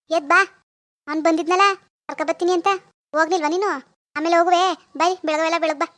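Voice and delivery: loud, nagging reprimand; bossy mother-in-law telling-off; scolding. Acted. Mother-in-law scolds idle daughter-in-law.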